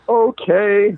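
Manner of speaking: put-on high, grudging child's okay; reluctant little girl. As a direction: give in like a bribed kid. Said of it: "bribe accepted".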